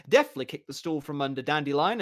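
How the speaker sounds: ordinary delivery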